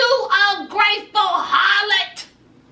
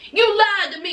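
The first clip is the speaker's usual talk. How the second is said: normal talking voice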